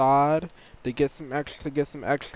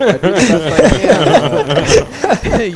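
nasal, laughing through it; 6 semitones higher, 10 dB louder, much slower than usual. Real, not acted. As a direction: talk through the nose, amused. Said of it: "Sounds like you're holding your nose".

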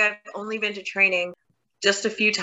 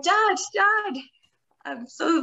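put-on scared child; 11 semitones higher, much slower than usual. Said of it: "like, I was scared".

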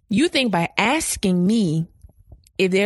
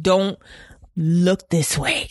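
slow cold warning; 3 semitones lower, much slower than usual. Retold or imitated. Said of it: "I'd be like".

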